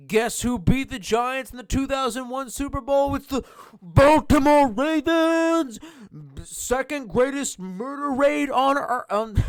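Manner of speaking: loud, high gloating shout at the Giants fan. Real, not acted. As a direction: yell it in triumph, rubbing it in.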